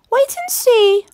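put-on high, bright old lady voice; wife proposing a plan to her husband; Missus Grinling. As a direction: play a brisk little old wife.

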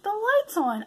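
put-on high childish announcement; little girl's voice. Goddaughter reporting the light to her aunt, high and childish. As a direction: say it like a six-year-old. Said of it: "and she's like".

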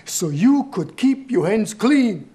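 loud, high, slow accusation; angry reproach. Acted. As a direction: drive home the sacrifice you made for them. Grandfather reproaches granddaughter.

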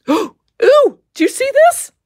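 gasping, voice leaping up high; sudden high squeal of discovery; surprised gasp. Real, not acted. Crafter showing viewers the worm, high surprised squeal.

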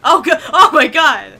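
loud, high exclamation; startled cry. Real, not acted. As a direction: cry out in sudden shock. Startled by game chaos.

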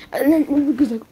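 normal talking voice

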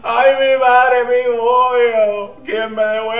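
overwhelmed excitement. Real, not acted. Overwhelmed by contestant's face.

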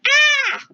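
sudden high shriek; the earth screaming. Pretend. The earth reacting to the creator's anger, a high shriek. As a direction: let out a short, high, panicked scream. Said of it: "The earth is like".

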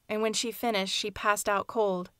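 ordinary delivery, nothing marked

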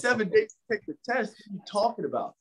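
high, incredulous squeak of disbelief; wide-eyed retold shock. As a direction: react like you can't believe the deadline. Stunned reaction to seven-day deadline.

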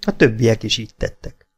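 usual speaking voice

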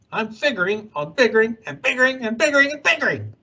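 rattled off fast, voice changed; rushed ranting. A narrator's reading. Onceler ranting at the Lorax, fast and worked up. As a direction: race through the biggerings in a frenzy. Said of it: "I got terribly mad".

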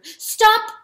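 loud, high shout; sharp command. A narrator's reading. Hen halting the rooster, sharp command. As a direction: cut him off at full voice. Hen's anguished outburst.

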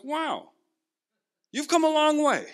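mock amazement at his wife's change; amazed. Amazed reply to wife agreeing.

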